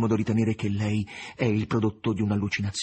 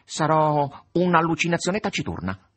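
cat's voice; 7 semitones higher, 4 dB louder than usual. A narrator's reading. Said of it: "ed egli rispose".

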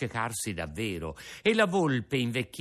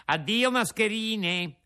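louder, higher taunt; mocking Pinocchio; 11 semitones higher, 4 dB louder than usual. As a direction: wave them off with scorn. Rispose il burattino.

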